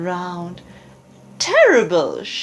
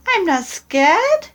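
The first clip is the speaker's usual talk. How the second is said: much higher, excited little monster voice; high eager child creature; Gruffalo's child; 8 semitones higher than usual. Acted. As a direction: squeak it out like a curious young monster. Child boasts.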